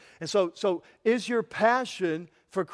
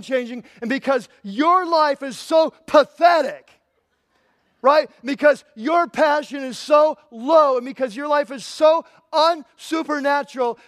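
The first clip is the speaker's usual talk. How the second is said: loud, high, indignant preaching; fiery rebuke; 5 semitones higher, 7 dB louder than usual. Real, not acted. Preacher rebuking lukewarm Christians, shouting and indignant. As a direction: pound it out like a furious preacher.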